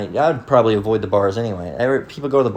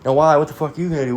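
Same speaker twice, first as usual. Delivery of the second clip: high, taunting dare; challenging thug; 5 semitones higher, faster than usual. Retold or imitated. Skinhead daring the dad at the door, high taunting voice. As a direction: taunt him to try something.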